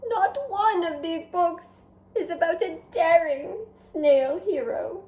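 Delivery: a hint of a vain French snail, chatty; lightly voiced French snail. Snail Escargot chatting with the reader, lightly French. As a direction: play the charming snail talking to the reader.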